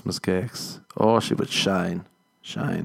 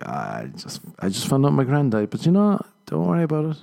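mock brave date; 6 semitones higher than usual. Acting out a date confession.

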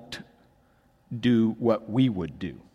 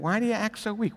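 mocking; 9 semitones higher, 4 dB quieter, faster than usual. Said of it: "You're like".

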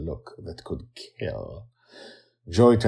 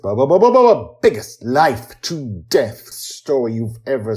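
mock stutter; 6 semitones higher, 12 dB louder than usual. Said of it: "mock stutter on biggest".

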